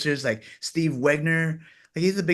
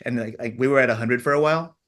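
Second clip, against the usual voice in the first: ordinary talk, nothing marked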